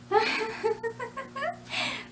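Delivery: long high staccato giggle; rapid high tittering in character. Shown as a demonstration. Creator giggles as the character for viewers, high titter.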